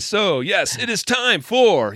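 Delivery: high, slow show-host announcer; animated broadcaster voice. Pretend. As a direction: draw it out high like a big announcer. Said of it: "we gotta be like animated stuff".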